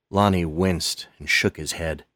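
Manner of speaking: usual speaking voice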